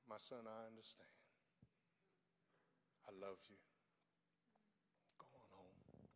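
tender, hushed words from above; gentle voice of Jesus. Retold or imitated. Jesus speaks to him softly.

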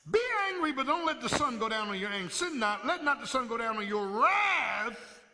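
high, loud reading of the verse; rising shout at "wrath"; scripture preached. Acted. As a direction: proclaim it to the church. Preacher reads scripture at full force.

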